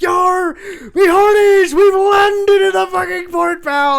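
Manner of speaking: high, very loud pirate holler; full-throated "yar, me hearties" shout; pirate yell. Acted. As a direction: bellow it like a pirate, words drawn out. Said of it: "I'd yell and be like".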